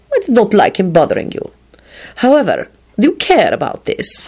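mildly petulant questioning. A narrator's reading. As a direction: press him with a touch of pique. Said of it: "he said petulantly".

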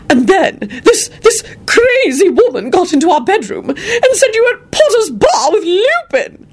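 high, drawn-out outraged screech. A narrator's reading. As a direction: screech indignantly like an outraged lady. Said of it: "Lady Fanshawe was screeching at Elizabeth".

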